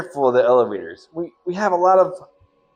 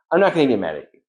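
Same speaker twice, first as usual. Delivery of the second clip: ordinary delivery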